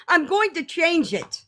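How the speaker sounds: ordinary talk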